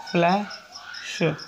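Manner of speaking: very slow, drawn-out word; teacher dictating a word; slow dictation. Shown as a demonstration. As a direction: stretch the word for the class. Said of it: "teacher dictating example word".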